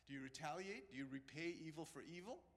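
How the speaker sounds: ordinary delivery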